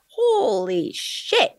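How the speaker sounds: very high, slow gasp of awe; awed holy shit, high and slow; stunned disbelief. Retold or imitated. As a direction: drag it out high in amazement. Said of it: "I was like".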